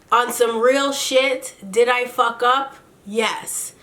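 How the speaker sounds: mock his slow, deliberate confession; slow-talking Kirk. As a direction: imitate a very slow talker, drawn out. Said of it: "He talks mad slow".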